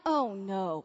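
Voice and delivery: low, soft, sinking reaction; retold dread. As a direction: let it sink quietly in mock dread. Retold dread as accusations are invited.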